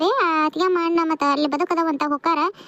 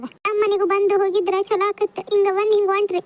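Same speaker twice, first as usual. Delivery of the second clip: high, tearful plea; crying; 5 semitones higher than usual. Acted. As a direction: plead tearfully at a raised pitch. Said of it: "ಅಳಬಾರ್ದ Sumathi ನೀನ್".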